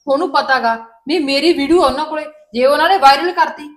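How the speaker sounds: loud, emphatic reassurance; forceful insistence. Acted. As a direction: drive it home loudly to calm her. Mother insists forcefully to daughter. (ਜ਼ੋਰ ਦੇ ਕੇ ਉੱਚੀ ਆਵਾਜ਼ ਵਿੱਚ)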